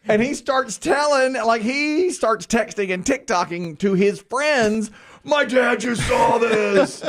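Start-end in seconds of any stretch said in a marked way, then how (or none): 5.2-7.0 high, drawn-out mock shout; imitated gleeful teen. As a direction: crow it slowly like a delighted teen. Son gleefully texting his friends.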